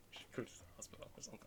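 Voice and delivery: whispered laugh. Real, not acted. Laughing aside about his cough.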